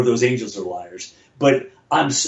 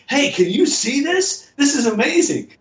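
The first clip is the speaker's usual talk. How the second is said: excited call out; 13 semitones higher than usual. Retold or imitated. Comedy writer yelling to a passerby, excited and high. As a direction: call out to a stranger, amazed. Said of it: "I'm gonna yell to them and say".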